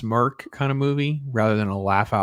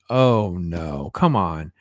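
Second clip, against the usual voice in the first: low, weary mock dismay; dismayed reaction; 7 semitones lower, much slower than usual. Reviewer reacting to the heroine's confession, dismayed. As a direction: lower your pitch for a pleading protest.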